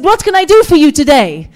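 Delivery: loud, high keen helper; imitated enthusiastic young salesman; eager offer. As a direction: offer help with bouncy eagerness. Acting the eager young man.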